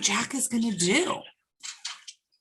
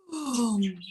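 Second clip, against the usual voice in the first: hushed boom; 7 dB quieter than usual. A narrator's reading. Sleep falls on giant.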